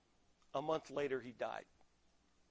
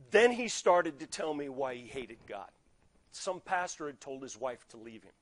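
usual speaking voice

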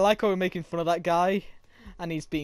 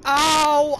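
pained yelp; 6 semitones higher, 6 dB louder than usual. Real, not acted. Hit in game.

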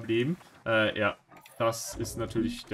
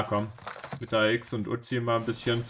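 ordinary talk

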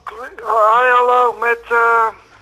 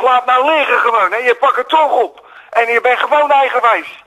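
exasperated scolding; 3 semitones higher than usual. Real, not acted. Caller scolding his friend Dennis while on the radio, exasperated. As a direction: tell off a friend who won't listen.

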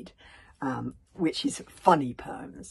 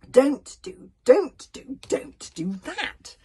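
loud, high scolding parent; a parent telling the child off, hammed up; nagging parent; 11 semitones higher, 10 dB louder, much slower than usual. Parent scolding the child: loud, high nagging. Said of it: "this is the parent talking to the child".

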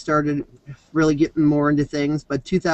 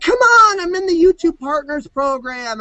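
much higher, whiny begging, hammed up; mock whining plea; 8 semitones higher than usual. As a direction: pretend to beg the trolls for attention.

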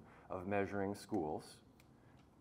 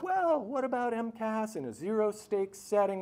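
mock objection; 12 semitones higher, 5 dB louder than usual. Mocking an opponent's objection.

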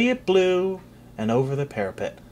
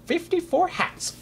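high, softer nervous count; nervous gulp; 11 semitones higher, 4 dB quieter than usual. Acted. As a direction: gulp it out anxiously. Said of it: "gulped Sir Ulrich".

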